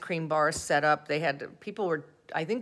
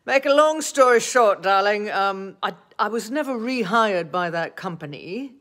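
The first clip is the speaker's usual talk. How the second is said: higher, louder hammed-up British; mock British accent; 5 semitones higher, 9 dB louder than usual. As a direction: put on a theatrical British accent to wrap up the joke. Jokey put-on voice closing the story.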